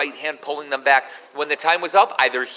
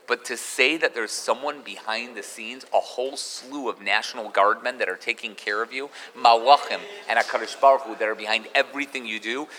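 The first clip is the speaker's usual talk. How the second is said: ordinary talk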